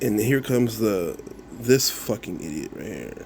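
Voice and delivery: usual speaking voice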